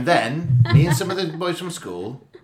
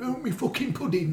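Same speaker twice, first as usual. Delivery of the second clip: granddad voice; 10 semitones higher, 4 dB quieter than usual. Retold or imitated. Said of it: "I liked was granddad".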